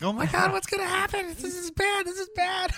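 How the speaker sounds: high panicky monster voice; mock panic. Friend voicing the worry monsters, high mock panic. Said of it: "the ones worrying, like".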